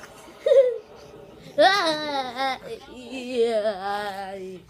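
loud crying laugh; sobbing laugh; laughing fit. Real, not acted. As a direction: break up in wailing laughter.

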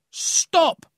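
sharp high loud call to halt; loud commanding call. A narrator's reading. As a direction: stop him in his tracks with a shout. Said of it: "the captain called out".

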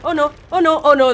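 fleeing a monster in a game, panicking; panicked. Real, not acted. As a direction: chant it as panic builds. Panicked chase, repeated oh no.

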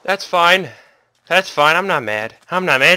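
ordinary talk, nothing marked

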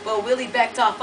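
ordinary delivery

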